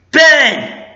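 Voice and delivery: high, loud acted call. Fan redoing Han Solo's call to his son, calling out. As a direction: shout a name to someone at a distance.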